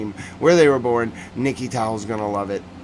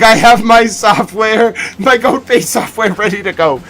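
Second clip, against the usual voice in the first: eager Mimi impression; 9 semitones higher, 10 dB louder than usual. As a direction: announce it like an eager assistant. Mimi eager with goat software.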